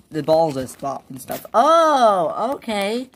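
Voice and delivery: normal talking voice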